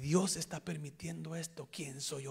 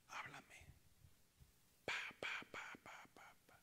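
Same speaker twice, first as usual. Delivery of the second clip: hushed prayer then whispered pa pa pa; whispered retold prayer; 11 dB quieter than usual. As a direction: whisper it like a secret. Whispered prayer, mimicking God.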